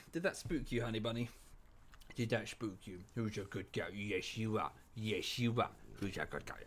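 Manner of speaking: soft, slurry words for a beloved pet; doting pet talk. Real, not acted. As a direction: coo to your dog, low and hushed. Baby-talking to the dog.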